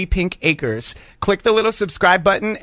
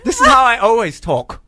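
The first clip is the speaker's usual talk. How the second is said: higher, fake British accent; mock-earnest put-on British accent; 4 semitones higher than usual. Shown as a demonstration. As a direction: claim it in a hammy British voice. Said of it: "Can you just do your British accent one more time?".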